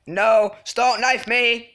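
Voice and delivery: high, drawn-out slow protest; stretched-out wail of dread; alarmed plea. Real, not acted. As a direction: plead loudly as the knife comes. About to be knifed.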